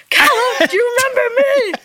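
very high, laughing fan call; mock excited fan. Fan in front row calling to singer, playfully over-excited. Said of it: "I'm so excited".

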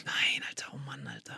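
exasperated whisper. Real, not acted. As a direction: whisper it in disbelief. Reacting to a cheeky interruption.

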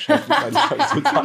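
high-pitched mock panic; squeaky panic. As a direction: squeak it out in desperation. Inner panic, much higher.

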